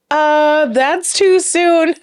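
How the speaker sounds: high, drawn-out "too soon"; mock awkward objection. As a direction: imitate hosts balking, stretching it out slowly. Hosts react to his tweet.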